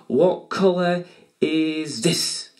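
stretched, teasing quiz question; slow teasing question. Acted. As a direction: draw the question out slowly to build suspense. Drawn-out quiz question to kids.

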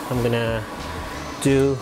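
ordinary talk, nothing marked

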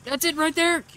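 high, excited shout. Real, not acted. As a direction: call out the find. Spots the radio.

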